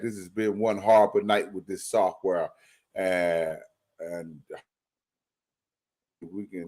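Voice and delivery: choppy, dropping out like bad radio; breaking up. Real, not acted. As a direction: voice cutting in and out. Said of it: "you breaking up real bad".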